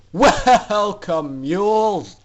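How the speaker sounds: loud booming welcome; powerful command. Acted. Doctor Atmosphere loudly greeting the foals as mules. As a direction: boom it out to the captives. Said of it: "a large and powerful voice commanded".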